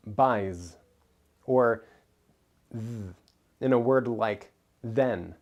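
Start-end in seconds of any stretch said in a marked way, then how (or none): 2.7-3.1 a held voiced th, softly buzzing; isolated soft voiced th; buzzing TH sound. Shown as a demonstration. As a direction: let the th hum on its own. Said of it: "hear a buzz in voiced sounds".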